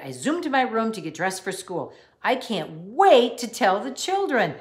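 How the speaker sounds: plain reading voice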